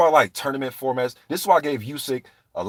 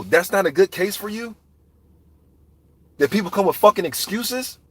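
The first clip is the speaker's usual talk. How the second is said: ordinary talk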